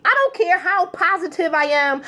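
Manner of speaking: usual speaking voice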